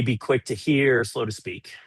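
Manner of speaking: ordinary delivery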